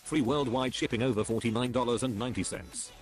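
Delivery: ordinary talk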